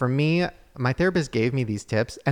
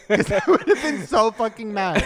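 high, loud laughing through the words; laughing mock anger; 8 semitones higher, 5 dB louder than usual. Real, not acted. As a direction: laugh while pretending to be furious. Laughing mock threat to friend.

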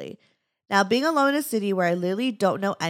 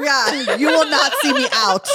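playful boast; 7 semitones higher, 7 dB louder than usual. Real, not acted. Laughing homebody boast.

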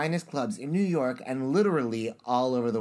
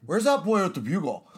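lightly put-on straight-guy voice, pitched up; mock straight man; 6 semitones higher, 3 dB louder than usual. Shown as a demonstration. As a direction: play at being a straight man. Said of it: "Now do it straight".

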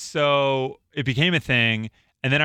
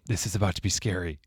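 leaning-in whisper; 7 semitones lower, 7 dB quieter than usual. Retold or imitated. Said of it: "he would lean over and go".

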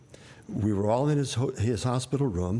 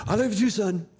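dad forcing the words out quickly to get it over with; blurted out fast; 11 semitones higher, 4 dB louder than usual. Retold or imitated. Father to son on the phone, blurted out in a fast rush. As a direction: spit it out fast and high, all in one rush. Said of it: "he spit out as fast as he could".

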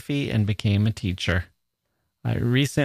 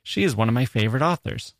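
ordinary delivery

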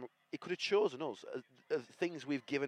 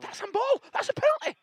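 much higher, louder appeal; high outraged appeal; 7 dB louder than usual. Real, not acted. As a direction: scream for the penalty. Appeals at the match.

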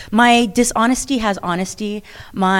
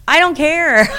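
laughing; 9 semitones higher, 5 dB louder, slower than usual. Real, not acted. Laughing off a jealousy question.

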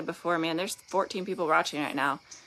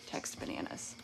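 whisper; 13 dB quieter than usual. Pretend. Secret passcode aside to Nick.

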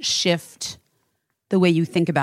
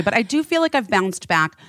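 normal talking voice

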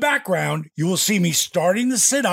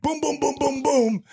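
rapid-fire spoken booms, pitched up; imitated gunfire; 9 semitones higher than usual. Movie heroes all shooting, imitated gunfire. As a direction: voice a string of mock gunshots.

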